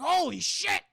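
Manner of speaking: hammed-up astonishment; mock shock. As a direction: exclaim as if stunned by a crash. Acting old man's shock at crash.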